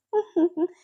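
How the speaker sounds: high, loud burst of laughter. Acted. Jiang Cheng keeps laughing at Wing, loud high laugh. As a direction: cackle at your friend's expense. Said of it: "बहुत जोर-जोर से हंस रहा था".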